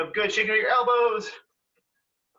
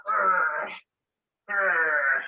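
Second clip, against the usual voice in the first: bear growl; 7 semitones lower than usual. Pretend. Acting like a bear.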